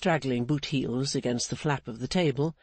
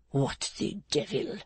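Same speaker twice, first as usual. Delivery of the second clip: usual speaking voice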